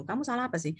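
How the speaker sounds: soft, puzzled question; puzzled husband. Retold or imitated. Husband asking his wife what went wrong, puzzled. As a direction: ask quietly, as if baffled. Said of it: "suami saya juga bingung".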